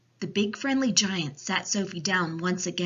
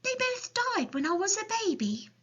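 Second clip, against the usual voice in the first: put-on high-pitched little girl voice; small, high child's reply; Sophie. A narrator's reading. Sophie explains to the giant.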